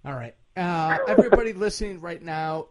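usual speaking voice

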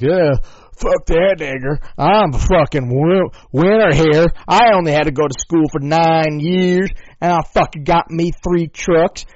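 a lightly put-on Southern accent; bragging yokel in a Southern accent; mock Southern accent. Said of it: "the stereotypical voice from the South".

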